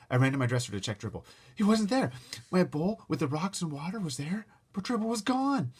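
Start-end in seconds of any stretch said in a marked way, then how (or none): 1.5-5.7 rising panic, higher and louder; alarm. Acted. Boy finding his turtle missing, panicky and loud. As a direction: voice climbs in alarm.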